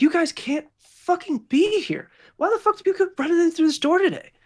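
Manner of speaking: very high, loud exasperated rant; angry nurse scolding. Acted. Nurse telling intruders they can't be here, angry scolding.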